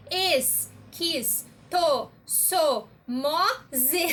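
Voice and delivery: esquistossomose broken into six syllables es-quis-to-so-mo-ze. Shown as a demonstration. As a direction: count out each syllable apart. (sílaba por sílaba, es-quis-to-so-mo-ze)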